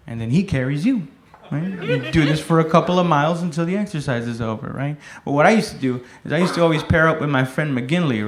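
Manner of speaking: ordinary talk, nothing marked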